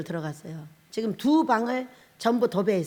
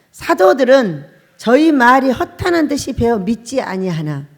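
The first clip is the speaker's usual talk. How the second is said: scripture reading; 9 dB louder than usual. Acted. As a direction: proclaim the verse to the congregation. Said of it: "십이 절 읽습니다".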